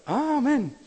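very loud, high yelled amen; old brother yelling amen again; shouted amen. Retold or imitated. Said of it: "ruft der Bruder wieder".

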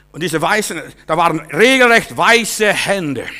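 loud, high, slow story climax; gripping revival storytelling; dramatic loud preaching. Acted. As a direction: tell the miracle with force.

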